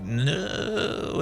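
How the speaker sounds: high, strained cry of "No"; imitating a horrified no; mock terrified no. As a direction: recoil from the thing in the hallway. Mike sees Foxy at the exit.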